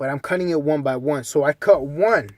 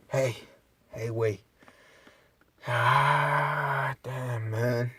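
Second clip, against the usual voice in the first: soft, lower, slow dreamy drawl; 6 semitones lower, 13 dB quieter than usual. Retold or imitated. Pedro telling the narrator how great he feels, dreamy drawl. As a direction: float like everything feels great.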